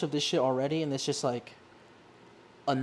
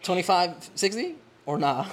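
sassy retort; 5 semitones higher, 8 dB louder than usual. Retold or imitated. Speaker retorts to customer.

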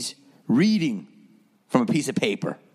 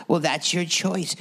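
higher, dismissive therapist voice; lightly mocking the therapist; 6 semitones higher than usual. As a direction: toss it off like a smug therapist. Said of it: "And she goes".

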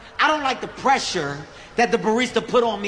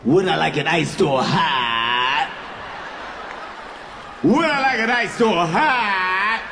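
slow, dazed repeat of the question; nervous repeating; 3 semitones lower, slower than usual. Retold or imitated. A flustered customer repeating the barista, slow and dazed. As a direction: stall by parroting the question. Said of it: "when I get nervous, I just repeat shit".

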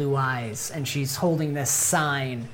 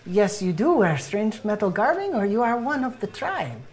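put-on higher-pitched, accented character voice; friendly evangelist in a raised put-on voice; welcoming missionary; 6 semitones higher than usual. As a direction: welcome the stranger earnestly. GM plays Bohan missionary.

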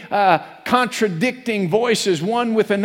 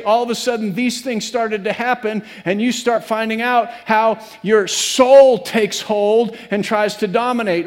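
normal talking voice

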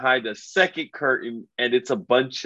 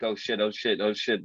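panicked muttering; 6 dB quieter than usual. Retold or imitated. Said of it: "they're like".

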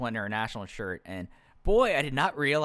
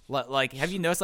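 usual speaking voice

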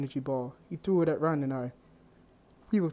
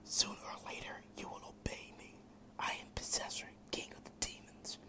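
soft, breathless whispering demon; demon king whisper; 14 dB quieter than usual. A narrator's reading. Demon king whispering inside Drake's head, menacing whisper. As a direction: hiss it like a voice inside his head.

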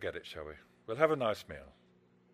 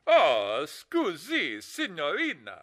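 mock Italian; 3 dB louder, much slower than usual. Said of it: "that phony Italian accent".

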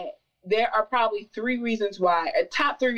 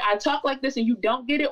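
ordinary delivery

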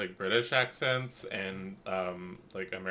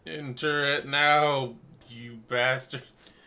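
louder, slow mock order; mock commanding promo; 5 semitones higher, 7 dB louder, much slower than usual. Co-host ordering listeners into the lotto, mock commanding. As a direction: draw it out like a bossy plug.

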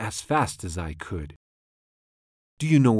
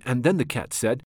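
plain reading voice

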